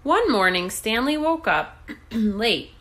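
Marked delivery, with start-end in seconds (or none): none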